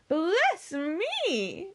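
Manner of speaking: high, astonished exclamation; excited cry. Acted. Mary on hearing the name Elliot, excited cry. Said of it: "cried Mary".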